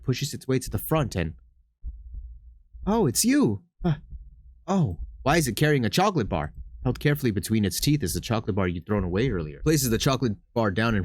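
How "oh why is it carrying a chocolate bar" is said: ordinary talk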